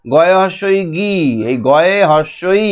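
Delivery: slow, drawn-out spelling. Shown as a demonstration. Teacher shows what stays silent inside, spelled out slowly. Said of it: "ভেতরে ভেতরে বলবো".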